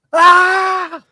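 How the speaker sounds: high, loud re-enacted cinema scream. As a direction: let out a mock scream at a horror film. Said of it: "Proper fucking loud screaming".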